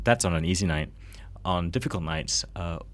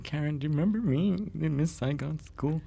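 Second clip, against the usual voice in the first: mock groupie calling timidly to a star; eager groupie; 9 semitones higher than usual. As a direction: imitate a starstruck fan, pitched up high. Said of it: "waited like a groupie backstage".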